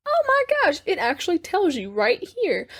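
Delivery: high-pitched "oh my gosh" settling down; retold astonishment, starting high; amazed realization. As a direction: recreate a gasped-out discovery in a high voice. Retells own amazed discovery.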